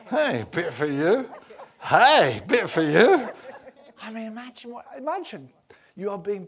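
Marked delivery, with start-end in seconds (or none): 0.1-3.3 growing excited amazement. Acted. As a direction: call it out in mounting delight. Peter amazed as food multiplies.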